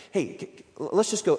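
usual speaking voice

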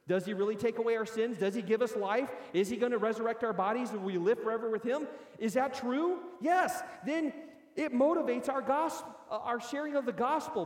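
preacher driving home questions to the church, loud; preaching at full force. Real, not acted. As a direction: fire up the congregation.

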